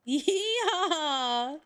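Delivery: high, drawn-out yeehaw; playful rodeo holler; mock cowboy whoop. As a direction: whoop it like a hammed-up country cowboy. Playing up being called rural.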